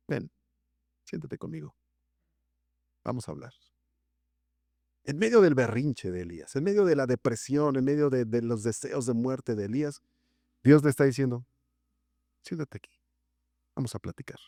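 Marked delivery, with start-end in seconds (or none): 0.1-3.5 softer, lower and gentle; tender invitation. Acted. God invites a despairing Elijah to sit, tender hush. 12.4-14.4 softer, lower and gentle; tender invitation. Acted. God invites a despairing Elijah to sit, tender hush.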